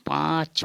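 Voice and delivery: पाँच with the vowel sent through the nose; nasal pronunciation. Shown as a demonstration. Teacher showing the Assamese five, through the nose. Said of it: "नाक से उच्चारण होगा".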